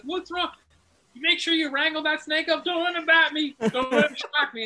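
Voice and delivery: high, loud hammed-up Texan; mock Texas accent. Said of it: "he just sounds like a Texas dude".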